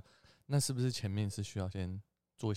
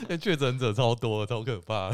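laughing, louder; 7 dB louder than usual. Real, not acted. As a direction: laugh through the remark. Laughing aside. (笑着说)

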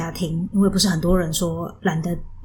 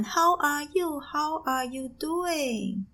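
mom's eager English; 9 semitones higher, 5 dB quieter, much slower than usual. Retold or imitated. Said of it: "一直疯狂的问".

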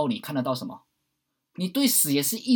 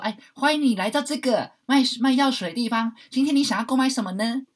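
high, bright-sounding greeting, louder, sing-song; shopkeeper NPC; 10 semitones higher, 6 dB louder than usual. Pretend. Game potion seller greeting the player, sing-song. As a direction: chirp like a cheerful game merchant. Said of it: "买东西的时候".

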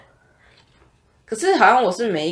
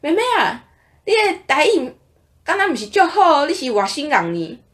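put-on quoting an old man; 6 semitones higher than usual. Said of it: "那个阿伯就说".

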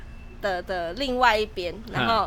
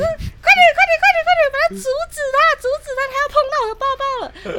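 much higher, louder, rushing frantic cries; frantic high scream to boyfriend, speeding up; panicked screaming; 9 dB louder, faster than usual. Retold or imitated. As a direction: shriek for help, faster and faster. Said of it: "我就这样尖叫。".

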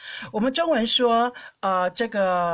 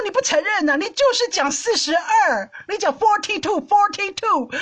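higher, insistent and teasing, repeating the number; mock insisting; 9 semitones higher, 3 dB louder than usual. Accusing her husband of saying forty-two, mock insistent. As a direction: pin him down, half joking.

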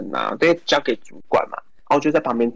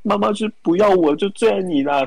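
mock wailing; 7 semitones higher than usual. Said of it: "放声痛哭的".

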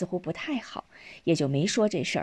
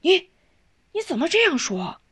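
shocked, much higher pitch; startled; 11 semitones higher, 3 dB louder than usual. A narrator's reading. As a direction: caught off guard by the son's words. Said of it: "少元一惊". (吃惊地，声调拔高)

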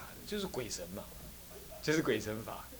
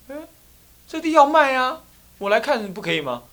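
defensive retort; 10 semitones higher, 6 dB louder than usual. Acted. Visitor argues back.

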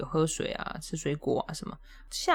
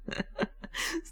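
breathy embarrassed laugh; 9 dB quieter than usual. Real, not acted.

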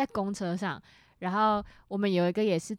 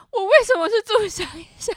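high, loud outraged whine; indignant protest; 13 semitones higher, 11 dB louder than usual. Retold or imitated. Daughter protesting to her mother, loud indignant whine. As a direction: protest like a wounded kid.

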